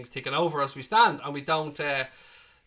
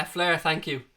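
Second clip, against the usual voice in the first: normal talking voice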